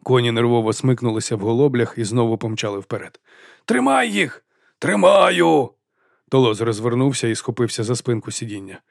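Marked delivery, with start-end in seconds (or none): 3.6-4.4 high urgent call; urgent shout. A narrator's reading. Panic on runaway cart. 4.8-5.7 louder, higher straining reply; the driver shouting back while holding the horses, loud. A narrator's reading. Panic on runaway cart.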